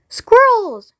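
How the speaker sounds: high, loud shriek of a word; shouted. Acted. Veruca spotting the squirrels, high loud shout. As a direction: yell it out, grabby. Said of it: "shouted Veruca Salt".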